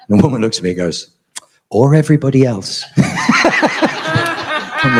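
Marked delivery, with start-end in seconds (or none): 1.7-5.0 high voice bursting into laughter; laughing. Real, not acted. Nurse teasing him on the way to ICU, laughing. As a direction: laugh through the punchline.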